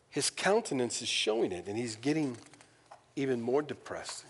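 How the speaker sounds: ordinary delivery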